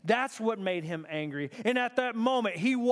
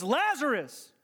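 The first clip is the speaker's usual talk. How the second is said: raised, drawn calling of a name; loud cry; 6 semitones higher than usual. Retold or imitated. Jesus crying out to his friend, loud. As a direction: call it out, pitch up. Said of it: "He cries out in a loud voice".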